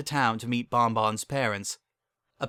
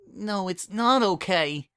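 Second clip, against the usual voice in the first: higher, slow tearful protest; blubbering in a higher voice; 8 semitones higher, slower than usual. Acted. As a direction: choke it out through tears. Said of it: "blubbered Bon Bon".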